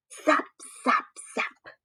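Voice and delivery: soft voiceless zaps; zapping sound. Acted. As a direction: snap out each zap softly. Lengua atrapa moscas. (efecto de zap, suave y sin voz)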